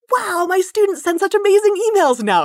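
high-pitched mock amazement; amazed professors. Professors reporting back on their students' improved emails, imitated amazement. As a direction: gush like an impressed professor.

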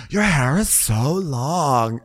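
slow, stretched-out gush; lingering imitation of idle admiring chat; oblivious mock gushing. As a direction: draw the words right out, blissfully oblivious. Mimicking Lisa's oblivious small talk.

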